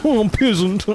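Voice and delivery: high, haughty snooty noble; aristocrat voice. Acted. Streamer showing off aristocrat voice to chat, haughty. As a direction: sneer down at the peasant. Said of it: "You like my aristocrat voice?".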